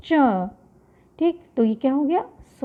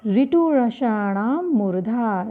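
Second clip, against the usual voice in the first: slow sutra recitation; slower than usual. Shown as a demonstration. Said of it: "इसका सूत्र है".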